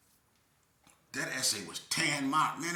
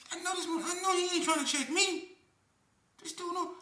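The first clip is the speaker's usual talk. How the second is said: high incredulous voice. Retold or imitated. His own thought, voiced high.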